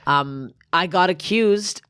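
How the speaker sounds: usual speaking voice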